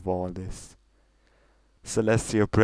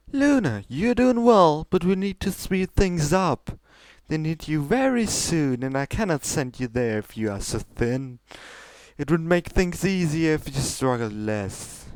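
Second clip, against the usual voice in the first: high, regal princess voice pushing her sister; lofty royal sister, coaxing; Celestia's voice; 8 semitones higher, 3 dB louder than usual. Acted. Older sister pressing Luna to go on, high and regal.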